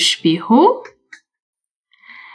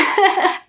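giggle; 7 semitones higher than usual. Real, not acted. Laughs after song.

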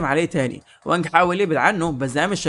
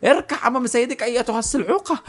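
higher-pitched mock villain order; haughty acted command; imperious command; 7 semitones higher than usual. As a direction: order them to kneel, lightly hammed up. Villain orders captive to kneel.

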